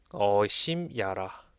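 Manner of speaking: normal talking voice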